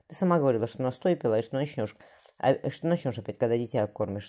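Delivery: plain reading voice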